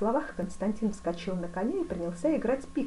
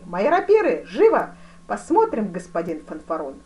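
commanding shout; 2 semitones higher, 10 dB louder than usual. A narrator's reading. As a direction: order it up at once, voice raised. Duke shouts orders to his aide. (громко, повелительно)

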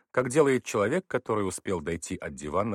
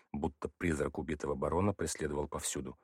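usual speaking voice

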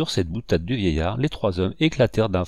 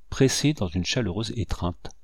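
ordinary delivery

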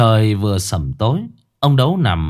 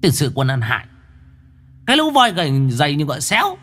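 rising to much higher, fast at the end; scolding curse; 6 semitones higher, faster than usual. Acted. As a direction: spit abuse at the worthless lot. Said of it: "mắng chửi người ta".

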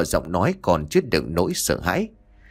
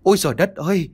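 panicked screaming; 8 semitones higher, faster than usual. Acted. Nam Phát screaming to the neighbours about the ghost, terrified cry. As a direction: scream for the village like the ghost is still on you. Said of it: "tiếng la hét đầy hốt hoảng".

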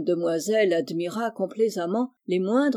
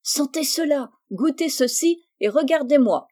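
higher, louder narrated outburst; lively cry; 6 semitones higher, 4 dB louder than usual. A narrator's reading. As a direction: call out eagerly, lifting pitch and volume. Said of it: "en entendant crier aux oreilles". (cri vif, plus haut et plus fort)

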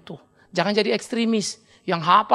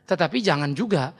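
ordinary delivery

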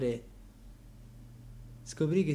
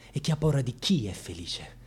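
whisper. Acted. Monologue on fear of happiness.

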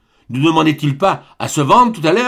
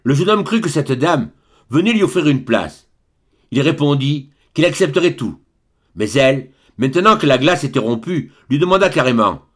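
ordinary delivery, nothing marked

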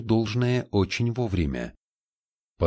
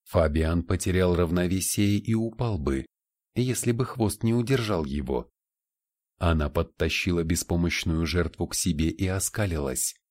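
ordinary delivery

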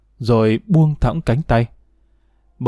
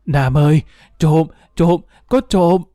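urgent higher-pitched cry, not full volume; calling out in alarm; 4 semitones higher than usual. A narrator's reading. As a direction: grandmother raising the alarm, acted by a narrator. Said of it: "Bà ngoại vội kêu lớn".

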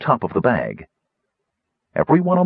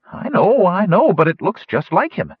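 high-pitched, agitated protest; excited insistence; 10 semitones higher, 3 dB louder than usual. A narrator's reading. Wingover insists to Sturm about what he saw, agitated.

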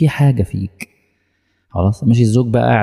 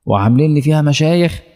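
imitating an appalled questioner, pitch raised; shocked listener; 5 semitones higher than usual. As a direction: exclaim in high-pitched, scandalised disbelief. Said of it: "تقول لي: "يا نهار أبيض!". (استنكار مصدوم بصوت عالٍ الطبقة)

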